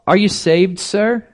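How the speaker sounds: "Are you saved, sir?" pitched high, rising sharply on "sir"; a small earnest girl's question, voiced up high; young lassie asking. Preacher voicing the young lassie questioning the bishop, high and earnest. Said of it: "a little Salvation Army".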